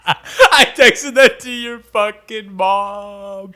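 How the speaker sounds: slow words through laughter; laughing. Real, not acted. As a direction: laugh it out in disbelief. Laughing at own blunder.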